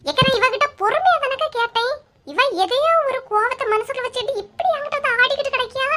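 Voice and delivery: indignant complaint. Acted. Neighbour accuses Lakshmi of grudge.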